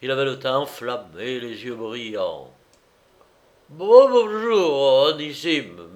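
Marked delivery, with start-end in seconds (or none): 3.7-5.9 high, loud, slurred greeting; slow boozy stammer, pitched up; friendly drunken stammer. A narrator's reading. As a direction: draw out a tipsy, amiable hello. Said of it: "balbutia-t-il d'un ton amical".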